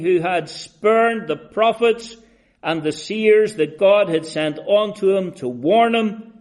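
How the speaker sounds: loud, high-pitched sermon delivery; impassioned preaching. Real, not acted. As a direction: thunder out the sinner's record, then God's mercy. Preaches at full force.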